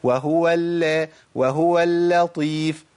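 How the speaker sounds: slow, light lam in اللطيف; gentle light lam. Shown as a demonstration. Teacher slowly models اللطيف with a light lam. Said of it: "بص أنا أقولها إزاي؟".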